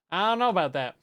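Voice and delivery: emphatic skeptical repeat. Shown as a demonstration. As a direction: push it up with doubt. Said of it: "louder take".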